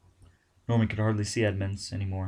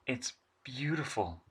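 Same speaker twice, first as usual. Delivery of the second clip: awed over intercom; 4 semitones higher, 8 dB quieter, slower than usual. Acted. Edmund speaks over the intercom.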